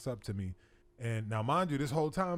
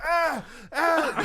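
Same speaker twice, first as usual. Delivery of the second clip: high loud cries of pain; 13 semitones higher, 7 dB louder than usual. Retold or imitated. Prisoner crying out under the chest bar. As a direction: yelp as the chest bar presses. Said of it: "I'm like".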